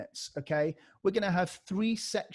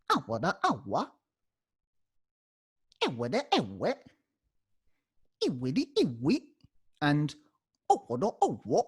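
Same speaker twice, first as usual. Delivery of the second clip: awada awa as a record scratch, pitch swooping; vocal scratch. Shown as a demonstration. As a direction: scratch each syllable like a DJ turntable. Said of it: "we actually use the scratch".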